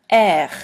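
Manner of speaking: R hacked up from the throat; throaty French R, spat out. Shown as a demonstration. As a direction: hock the R like clearing your throat. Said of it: "You have to kinda hackle it up".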